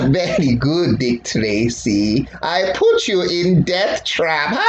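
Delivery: mock villain voice. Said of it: "And then he'd be like".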